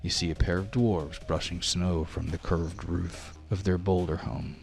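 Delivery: ordinary delivery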